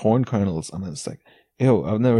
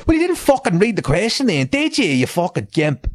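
much higher, scornful put-down; exasperated mock rant; 10 semitones higher than usual. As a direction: sneer at him like he's an idiot. Ranting at a commenter's answer.

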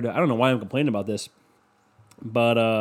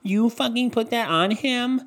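shocked onlooker; 9 semitones higher than usual. Retold or imitated. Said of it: "they would be like".

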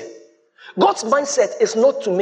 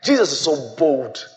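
usual speaking voice

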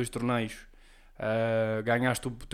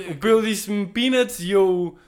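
mock justification; 6 semitones higher, 6 dB louder than usual. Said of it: "O gajo depois a justificar-se".

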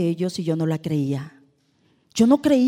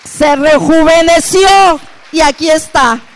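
loud, slow, triumphant announcement; triumphant shout; 7 semitones higher, 12 dB louder than usual. Real, not acted. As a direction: proclaim it like applause is due. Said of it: "Y un aplauso por ella".

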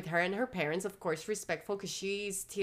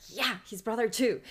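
annoyed; 2 semitones higher, 3 dB louder than usual. Retold or imitated. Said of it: "He's annoyed".

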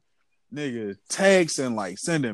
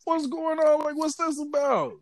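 raised pitch, playing dumb; innocent surprise; slower than usual. To his angry girlfriend, a high, mock-innocent whoa. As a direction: act like you know nothing, up high. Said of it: "I'm like".

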